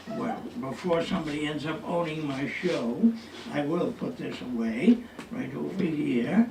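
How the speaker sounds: normal talking voice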